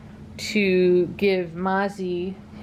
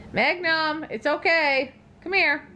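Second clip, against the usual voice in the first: calling out; 8 semitones higher, slower than usual. Real, not acted. Calling the dog over.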